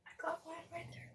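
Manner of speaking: whisper. Real, not acted. Child whispering beside the camera.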